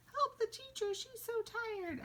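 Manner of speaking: very high mock plea; playful squealed wail of distress; exclaimed cry for help. Shown as a demonstration. Teacher pretends to need rescue, exclaimed cry. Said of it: "might use an exclamation point for".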